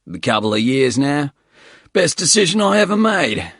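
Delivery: high, loud, boastful Aussie bloke; gruff Australian accent. Acted. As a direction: brag about cars in rough Aussie voice. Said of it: "rusty voice".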